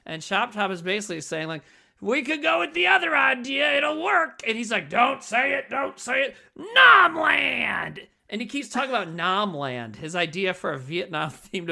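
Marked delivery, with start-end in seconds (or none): 2.0-4.4 higher, louder eager sales pitch; pushy manic pitch. Retold or imitated. Chop Top pushing his idea. 4.9-8.1 higher, louder mock exasperated yell; exasperated shouting. The cook snapping back.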